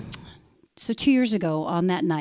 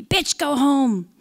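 higher, drawn-out mock yell; retold yell; 6 semitones higher, much slower than usual. Man yelling at the narrator in her building, retold mock yell. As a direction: imitate a yell without real volume. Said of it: "started yelling at me".

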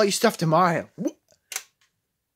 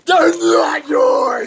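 shouting; 7 semitones higher, 6 dB louder, much slower than usual. Pretend. Arguing over lights.

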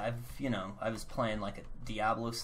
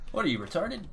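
mocking chatter; 8 semitones higher, 6 dB louder than usual. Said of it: "It's like".

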